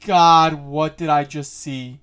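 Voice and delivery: very loud, high outburst easing down; startled shock. Real, not acted. Viewer shocked by what they just saw, startled loud cry. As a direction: cry out in real shock, drawn out at first.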